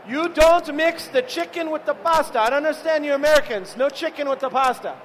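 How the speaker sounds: a playful take on an Italian chef lecturing Americans; imitating Fabio. Fabio lecturing the other chefs about pasta, mock Italian accent. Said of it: "Fabio starts telling us".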